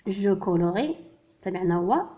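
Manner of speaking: ordinary delivery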